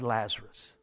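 low, soft, falling; understated naming. Retold or imitated. Preacher noting which name was called, understated. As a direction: drop the name in as a quiet aside. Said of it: "he said".

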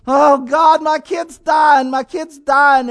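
loud, slow, anguished cry of a frightened father; a panicked father pleading loudly; desperate cry. Acted. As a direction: cry out that your child is dying.

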